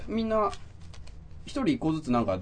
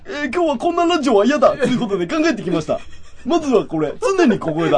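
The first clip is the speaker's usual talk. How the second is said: pitched-up, louder mock Takeshi voice; over-the-top Takeshi read, higher and louder; Beat Takeshi impression; 10 semitones higher, 10 dB louder than usual. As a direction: ham up a Takeshi radio bit, high and loud. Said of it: "高えな声が".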